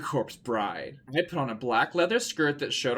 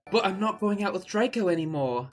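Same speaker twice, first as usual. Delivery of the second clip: mock pleading; 6 semitones higher than usual. Quarrel with Ebony.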